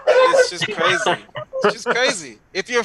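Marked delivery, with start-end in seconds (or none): 0.1-1.2 laughing squeal. Real, not acted. Repeated line, laughed.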